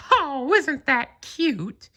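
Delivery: high falsetto, slow and drawn out; charmed Mickey. A narrator's reading. Mickey watching the kitten dance, high slow coo. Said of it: "Mickey exclaimed".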